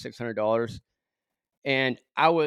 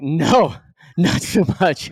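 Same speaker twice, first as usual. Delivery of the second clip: embarrassed laugh; 5 semitones higher, 6 dB louder, slower than usual. Retold or imitated. Admits things are bad, laughing.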